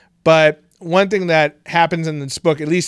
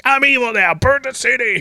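hammed-up villain; 9 semitones higher than usual. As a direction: ham up a sudden villain. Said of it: "she's like".

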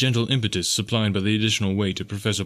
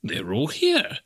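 mild exclamation, pitched up; exclaimed; 4 semitones higher, much slower than usual. A narrator's reading. Professor finding his body whole, exclaiming. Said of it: "he exclaimed".

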